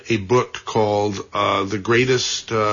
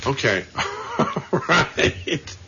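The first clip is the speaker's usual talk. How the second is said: laughing; 4 dB quieter, much slower than usual. Real, not acted. Laughing at the mix-up.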